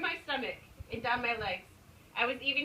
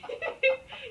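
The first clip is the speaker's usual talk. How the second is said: high laugh; 6 dB louder than usual. Real, not acted. Bursts out laughing.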